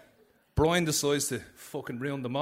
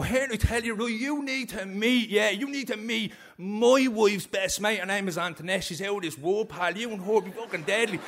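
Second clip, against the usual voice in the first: pushy prison mate; 5 semitones higher than usual. Retold or imitated. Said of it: "every day he'd go".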